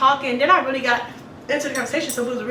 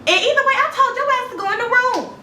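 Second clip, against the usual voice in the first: heated retort; 11 semitones higher than usual. Retold or imitated. Cussing Trevor out.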